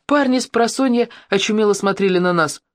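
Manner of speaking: ordinary delivery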